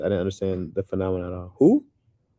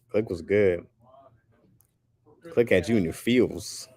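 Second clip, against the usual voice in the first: normal talking voice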